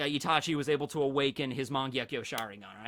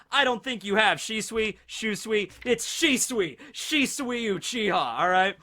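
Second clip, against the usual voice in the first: higher, louder gushing; excited; 5 semitones higher, 5 dB louder than usual. Real, not acted. Fan telling viewers Shisui is cooler, excited gushing. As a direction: gush to them about him.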